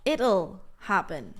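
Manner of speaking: slow, clear "It'll" as one syllable; slow contraction. Shown as a demonstration. Teacher demonstrating the contracted future to students. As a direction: draw out "It'll happen" to show the contraction. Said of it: "I like to contract will even more".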